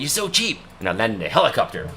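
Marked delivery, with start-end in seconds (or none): 0.0-0.6 higher, scolding mock father; imitating dad's scolding, pitched up; nagging dad. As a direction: whine at him like a stingy-hating dad. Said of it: "He's like".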